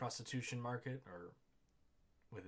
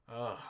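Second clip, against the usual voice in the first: loud disgusted groan; 2 semitones higher, 9 dB louder than usual. Real, not acted. Disgusted reaction.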